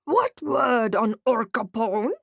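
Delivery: slow, blunt orc-style words; clipped orc talk. Acted. Undercover narrator asking the orc for news, slow clipped orc talk. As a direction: keep it short and slow like an orc. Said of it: "trying my best monosyllabic conversation".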